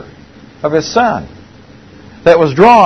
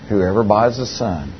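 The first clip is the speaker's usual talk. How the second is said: soft and solemn; 5 semitones lower, 7 dB quieter than usual. Retold or imitated. Punchline delivered softly.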